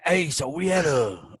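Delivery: ordinary delivery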